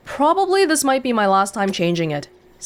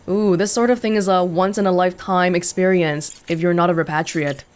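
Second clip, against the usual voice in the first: ordinary talk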